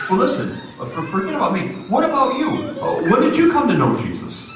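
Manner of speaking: high-pitched, excited imitation of her; eager questioning. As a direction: turn the question on him, bubbling and eager. Waitress eagerly turns question on him.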